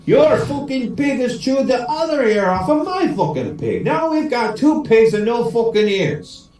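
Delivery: high, hammed-up Irish rant; storming angry. Swearing Paddy storms in on his mate, mock angry. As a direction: wail with indignant Irish exasperation. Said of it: "Paddy comes storming into the house".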